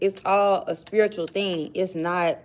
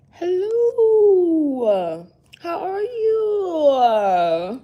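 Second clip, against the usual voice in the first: high, drawn-out cutesy hello; high sing-song greeting; 8 semitones higher, 5 dB louder, much slower than usual. Acted. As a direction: greet like a sweet little lady. Greets someone in put-on voice.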